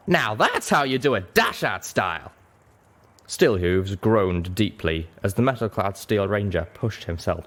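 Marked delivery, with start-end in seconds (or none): 0.1-2.3 lightly voiced boastful cowboy; proud cowboy. A narrator's reading. Calamity bragging to his friends after the crash, cocky. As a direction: crow about it, pleased with himself.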